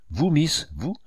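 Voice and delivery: bewildered exclamation. A narrator's reading. Samuel finding Miss Lucy there, bewildered. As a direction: blurt it out in bewilderment, rising. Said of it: "s'écria Samuel éperdu".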